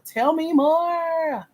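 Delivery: high, slow, stretched-out prodding; drawn-out coaxing. Real, not acted. Coach prodding an applicant for detail, drawn-out and coaxing. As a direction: draw the words right out, pitched high. Coaxing advisee to elaborate answer.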